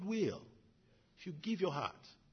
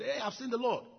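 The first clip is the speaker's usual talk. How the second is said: excited news; 10 semitones higher, 5 dB louder than usual. Acted. Mary telling disciples.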